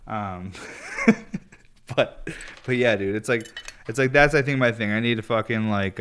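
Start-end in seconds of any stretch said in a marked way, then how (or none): 0.6-1.5 breathy high laugh. Real, not acted. Laughing at own rant.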